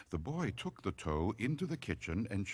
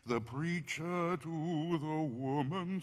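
higher, hushed, eerie storytelling with moaning oohs; creepy campfire poem with drawn-out oohs; spooky poem recital; 7 semitones higher, 3 dB quieter, slower than usual. A narrator's reading. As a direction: recite a ghost rhyme to give chills.

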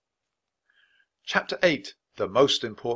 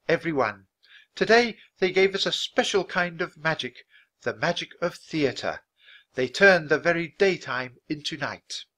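gracious queen; 7 semitones higher, 4 dB louder than usual. A narrator's reading. The Queen addresses the audience.

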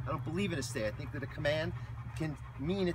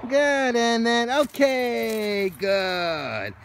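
1 praise voice; 7 semitones higher, 7 dB louder, much slower than usual. Real, not acted. Trainer praising dog.

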